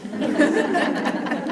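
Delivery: light amused laugh; breathy chuckle at herself; short laugh. Real, not acted. Laughs at own correction.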